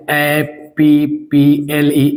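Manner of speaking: slow letter-by-letter spelling of ए पी पी एल ई; spelled out slowly. Shown as a demonstration. Teacher spelling apple slowly for students. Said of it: "Apple ले ले रहे हैं".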